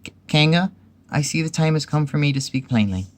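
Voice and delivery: high-pitched, stammered start; trying to be brave. A narrator's reading. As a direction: put on a brave little voice. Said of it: "said in a brave voice as he could".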